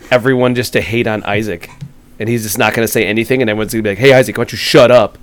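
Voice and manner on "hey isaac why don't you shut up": imitated annoyed yell, louder and higher; mock yelling. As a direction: raise your voice as if telling someone off. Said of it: "everybody just kinda yells at Isaac".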